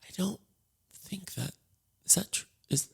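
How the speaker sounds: halting, hushed disbelief; doubtful whisper. Acted. Onlookers react to her bare-faced excuse, whispering doubtfully. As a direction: whisper it haltingly, as if unsure what to say.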